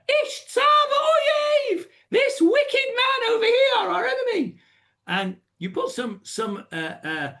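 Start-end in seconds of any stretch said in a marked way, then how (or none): 0.1-4.6 far higher, slow and louder; emotional accusation. Shown as a demonstration. Esther accusing Haman before the king, emotional. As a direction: cry out the accusation with full emotion. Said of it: "put some emotion into the story".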